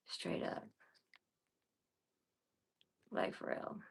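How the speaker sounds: whispered aside. Real, not acted. Streamer whispers her point to viewers, hushed whisper.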